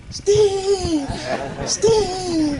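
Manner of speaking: high, loud panicky call through laughter; hammed-up frightened shouts for Steve; mock panicked yelling. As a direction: imitate a scared kid calling for help. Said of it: "[name] starts yelling".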